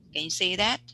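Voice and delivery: ordinary delivery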